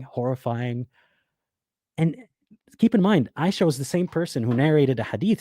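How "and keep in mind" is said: ordinary talk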